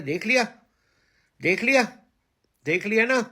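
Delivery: loud rising told-you-so; gloating. Real, not acted. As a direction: rub it in their faces. Gloating at former critics.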